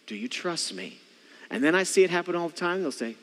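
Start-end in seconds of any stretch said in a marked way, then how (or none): 0.1-1.0 soft, low question; quiet check. Acted. Dad asking his kids about trust, quietly. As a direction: drop right down and ask gently.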